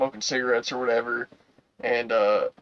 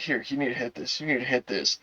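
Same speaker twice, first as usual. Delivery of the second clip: soft coaxing; 7 dB quieter than usual. Retold or imitated. Girl urging him quietly.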